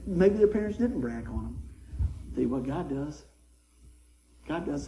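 normal talking voice

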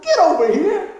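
high, loud summons with a laugh; grandma calling him over; hammed-up grandma call.

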